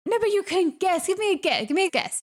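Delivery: bright pushy urging; eager coaxing. Real, not acted. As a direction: egg them on to guess. Coaxing a guess, raised.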